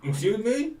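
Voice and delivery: high, loud indignant challenge; loud offended guard question. Acted. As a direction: catch them out. Guard overhears aside.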